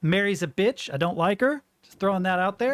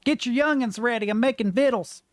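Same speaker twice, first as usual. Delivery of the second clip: put-on higher, folksy rural American accent; 6 semitones higher than usual. Rural American accent as the British equivalent of crude talk. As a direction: play a backwoods American cook calling the family. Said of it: "the equivalent of this".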